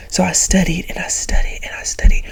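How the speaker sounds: hushed whisper of long study; soft unvoiced recounting. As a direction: breathe it out, almost secret. Recalling studying hard.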